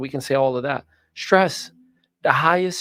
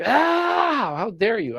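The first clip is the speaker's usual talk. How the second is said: higher, mock crying cry of offence; mock wail; 9 semitones higher, much slower than usual. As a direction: ham up a fake sob of outrage. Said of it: "I could be like".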